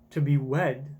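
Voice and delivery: ordinary talk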